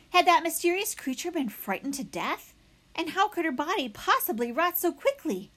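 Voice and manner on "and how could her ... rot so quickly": usual speaking voice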